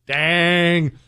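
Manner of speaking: normal talking voice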